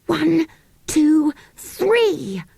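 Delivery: rally them for the yell; count-in. Acted.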